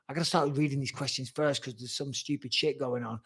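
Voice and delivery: unvoiced whisper, half to himself; soft whispered grumble to himself; whispered aside. Real, not acted. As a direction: breathe it as an aside. Muttered aside while reading chat.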